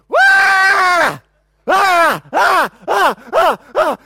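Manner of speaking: loud high wailing bursts; piercing mock infant cries, one after another; imitated baby wail. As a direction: bawl like a screaming baby. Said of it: "I would just hear our kid".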